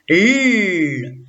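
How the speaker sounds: L sounded alone, long and held; son isolé. Shown as a demonstration. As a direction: hold the bare sound of L, no letter name. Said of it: "je rajoute le dernier son". (L prononcé seul, tenu longuement)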